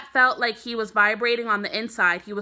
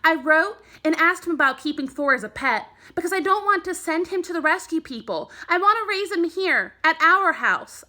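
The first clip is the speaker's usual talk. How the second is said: louder, higher, urgent rush; fast and loud; 8 semitones higher than usual. Acted. Boy pleading with Mom to keep his skunk, loud and urgent. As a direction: pour it out because it matters. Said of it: "The words came out fast and loud because they were important".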